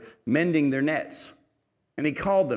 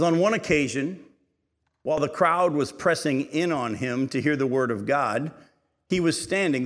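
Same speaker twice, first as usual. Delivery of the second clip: raised, elevated Bible reading voice; scripture reading; 3 semitones higher, 4 dB louder than usual. Acted. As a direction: read the Gospel out to the congregation.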